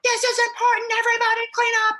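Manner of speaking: very high, fast, shrill-free frantic order; high hurried mock panic over a messy house; mock frantic mom. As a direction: rush the family like a panicked mom. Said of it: "my house goes disarray and I'm like".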